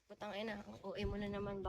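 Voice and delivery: ordinary delivery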